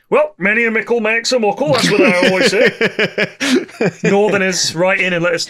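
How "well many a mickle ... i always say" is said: higher-pitched, hammed-up northern voice; put-on northern English accent. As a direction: say it like a proverb-spouting northerner. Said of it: "but it sounds northern".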